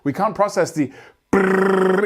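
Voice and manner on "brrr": fast rolled brrr. Shown as a demonstration. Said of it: "It becomes too much, too fast".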